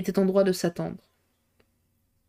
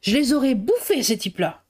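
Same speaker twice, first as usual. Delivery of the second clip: high-pitched burst of anger, dropping at the end; indignant outcry; 3 semitones higher than usual. A narrator's reading. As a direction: exclaim it in fuming indignation. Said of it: "le poing crispé, Marie-Jeanne s'écria".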